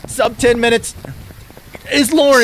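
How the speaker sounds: loud, rising hype commentary; excited play-by-play. Acted. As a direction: call the finish like a sportscaster. Live play-by-play at the finish.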